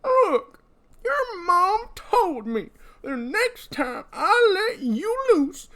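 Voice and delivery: put-on high, slow, quavering old-timer; old prospector. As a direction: whine it like a rattled old codger. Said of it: "It just really works for this voice".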